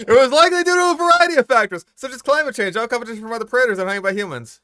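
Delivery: high, fast mock narrator voice. Mocking the narrator's line.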